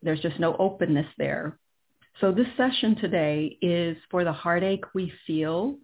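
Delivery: normal talking voice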